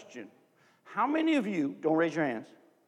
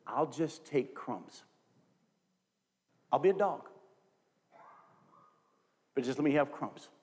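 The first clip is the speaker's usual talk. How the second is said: softer, lower, slowed humble request; humble pleading; 5 semitones lower, 4 dB quieter than usual. Retold or imitated. Canaanite woman humbly answering Jesus, soft and meek. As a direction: plead meekly, asking only for crumbs.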